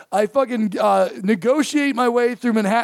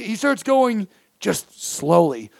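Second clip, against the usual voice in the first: usual speaking voice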